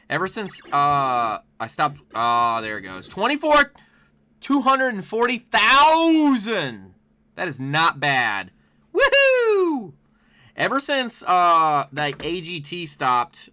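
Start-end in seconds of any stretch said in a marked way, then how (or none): 3.1-6.9 excited. Real, not acted. Reading out a big score. 8.9-9.9 high, loud whoop of joy; excited cheer. Real, not acted. Cheering at the score.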